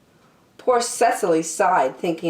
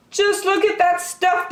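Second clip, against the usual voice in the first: mock shout; 14 semitones higher than usual. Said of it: "heard someone shouting".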